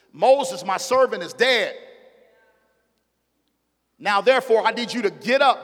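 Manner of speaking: loud, high preacher's cry of God's words; booming pulpit proclamation; full-force preaching. Acted. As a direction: preach it out, rising and ringing.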